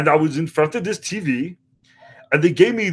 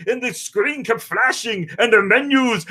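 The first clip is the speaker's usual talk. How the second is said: ordinary talk, nothing marked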